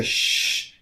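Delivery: demonstrated "shh" on blown-out air; hushing shh. Shown as a demonstration. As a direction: blow air out as if hushing someone. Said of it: "in a manner where you're telling somebody to".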